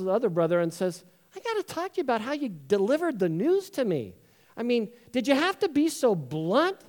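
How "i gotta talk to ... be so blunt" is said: mildly indignant, higher-pitched complaint; reproachful. Acted. Brother complains about how news was delivered, reproachful. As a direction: confront him with a touch of hurt. Acted quarrel between the brothers.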